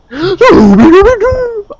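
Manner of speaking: very high, loud wailing squeal; comic wail of someone plunging down; long high-pitched silly howl; mock falling scream. Mimes leaping into the water.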